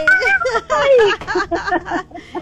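long, high burst of laughter; delighted high laugh. Real, not acted. Host laughs after cheering.

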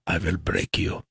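low, soft menacing Russian fighter; Ivan Drago. Retold or imitated. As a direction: threaten him low and cold. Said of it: "Ivan Drago".

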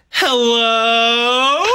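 high, loud drawn-out hello; high sing-song hello. Acted. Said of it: "I go like this".

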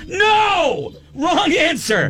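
high exclaimed no; hammed-up indignant exclamation; mock shout. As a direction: cut her off sharply. Retold outrage at the joke.

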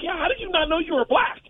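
high incredulous jab; mock disbelief. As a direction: make the question sound absurd.

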